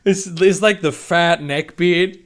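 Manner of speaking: usual speaking voice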